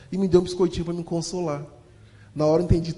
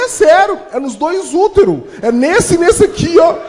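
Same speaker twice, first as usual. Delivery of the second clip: put-on high, loud, slow kid voice; boy insisting to the doctor; insistent kid; 10 semitones higher, 10 dB louder, slower than usual. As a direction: plead earnestly like a scared child.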